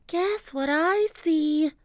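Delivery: put-on high, slow teasing girl voice; a kid holding a secret; excited girl. Annie teases Jack, high and slow.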